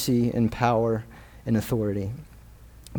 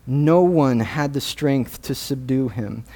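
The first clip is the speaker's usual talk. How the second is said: usual speaking voice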